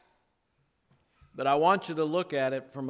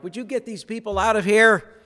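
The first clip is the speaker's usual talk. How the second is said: impatient disciples; 6 semitones higher, 9 dB louder, faster than usual. Retold or imitated. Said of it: "we might think the disciples are like".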